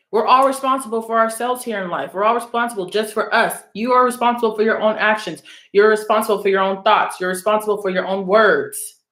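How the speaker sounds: usual speaking voice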